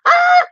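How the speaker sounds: high, loud excited scream; retold scream. Host reenacting her scream at the video, loud shriek. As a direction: shriek with sudden delight. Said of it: "I literally screamed".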